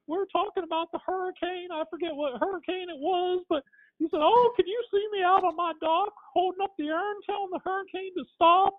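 sustained very high impression voice; high-pitched put-on character voice; Paul Bearer. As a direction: keep the whole story up in a high, wailing pitch. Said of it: "he's talking like this".

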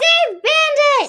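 high, slow shouted quote; quoting the show. Retold or imitated. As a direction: yell it like the sitcom scene. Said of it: "She throws her cat".

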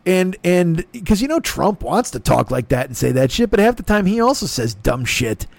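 normal talking voice

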